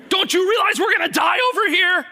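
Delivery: high, loud frantic shout; hammed-up kid yelling in panic; mock panicked scream. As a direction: scream it like a terrified child. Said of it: "they start screaming".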